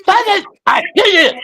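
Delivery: high shrieking puppet threat; Achmed the Dead Terrorist. Retold or imitated. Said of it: "Def Dunham's Ahmed".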